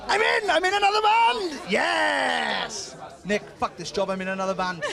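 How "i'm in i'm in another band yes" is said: excited shout. Pretend. Joking he joined the band.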